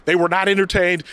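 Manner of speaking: ordinary talk, nothing marked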